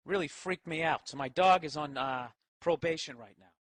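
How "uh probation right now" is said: ordinary delivery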